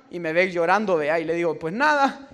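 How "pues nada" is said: high, loud little shrug of an answer; sheepish reply. Retold or imitated. Francisco caught crying by the prophet, high sheepish shrug. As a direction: brush it off, caught out.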